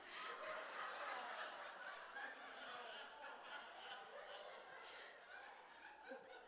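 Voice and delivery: long airy wheeze of laughter; breathless silent laugh. Real, not acted. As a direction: laugh till no sound comes out. Laughing after punchline.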